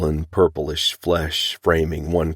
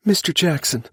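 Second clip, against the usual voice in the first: much higher woman calling a name; woman's voice; 13 semitones higher than usual. A narrator's reading. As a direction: call his name as the worried wife. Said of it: "the sound of a woman's voice".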